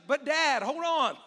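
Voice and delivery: put-on a boy suddenly realising something's missing; excited young Isaac calling out; urgent boy's call. Isaac voiced calling to father.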